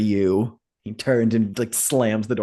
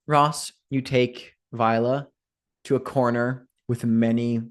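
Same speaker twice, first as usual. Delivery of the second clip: usual speaking voice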